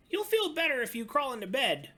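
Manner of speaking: very high, loud and fast cub voice; urging little cub. Acted. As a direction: rush it out like an eager cub. Little Cub urges Old Bear.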